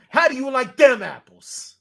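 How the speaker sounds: high, loud Boston taunt; hammed-up Boston movie quote; bad Boston accent. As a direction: crow it in broad Boston. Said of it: "saying things in a bad Boston accent".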